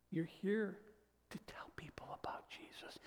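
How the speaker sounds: soft urgent whisper; whispered call to witness. Real, not acted. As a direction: lean in and whisper it. Sermon point whispered.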